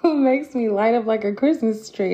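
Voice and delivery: beaming, with a laugh in the voice; smiling voice. Real, not acted. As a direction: let the smile come through. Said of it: "if you can hear my smile".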